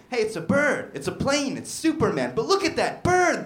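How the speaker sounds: high-pitched, exclaiming onlooker; excited bird enthusiast. Acted. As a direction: call it out like a thrilled birdwatcher. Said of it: "You got a guy being like".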